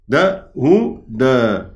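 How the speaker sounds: هدى stretched very slowly; drawn-out word. Shown as a demonstration. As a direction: linger on هدى, spelling it out with the voice. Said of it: "هدى برضو نفس الحكاية".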